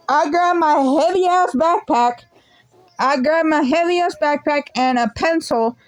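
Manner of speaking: usual speaking voice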